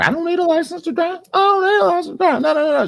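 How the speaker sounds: high, fast whiny woman's voice; squeaky mock of a stubborn driver. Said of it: "She just repeated that over and over".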